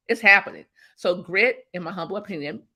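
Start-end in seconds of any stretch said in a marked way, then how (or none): 0.1-0.6 insist, louder than before; louder insistent repeat. Real, not acted. Motivational guest to host, louder insistent repeat. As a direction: punch it harder the second time. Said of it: "repeats louder for emphasis".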